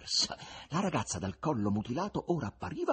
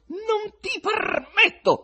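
much higher, louder, slow outraged shout; male shout; 9 dB louder, much slower than usual. Acted. Husband in the crowd forbidding his wife, outraged shout. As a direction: forbid her at full voice. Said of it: "si udì una voce maschile".

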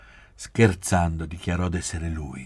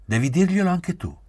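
high, loud, hard demand; stern, raised-voice insistence; harsh order; 12 semitones higher, 6 dB louder than usual. A narrator's reading. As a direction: order it forcefully, voice jumping up. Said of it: "Parlò con durezza".

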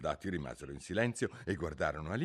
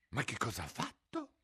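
Alice asking; 3 semitones higher, 6 dB quieter than usual. A narrator's reading. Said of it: "disse Alice".

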